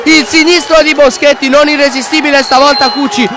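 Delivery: shouted, high-pitched goal call; breathless italian goal commentary. Acted. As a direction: scream the equaliser, breathless.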